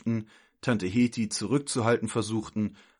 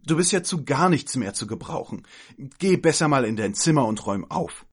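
loud, higher scolding; losing her temper; 4 semitones higher, 5 dB louder than usual. Acted. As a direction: send the child off in exasperation. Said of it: "Tante Heti platzte der Kragen". (laut und wütend schimpfend)

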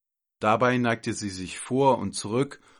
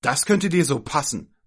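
loud, higher angry retort; furious; 5 semitones higher, 6 dB louder than usual. Acted. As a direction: throw it back at the child angrily. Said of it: "sagte sie wütend". (wütend und laut)

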